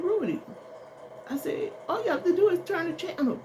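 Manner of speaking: high-pitched exasperated instruction; high, impatient mom telling her son. Retold or imitated. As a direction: tell him off with the pitch pushed way up. Mother telling son what to do.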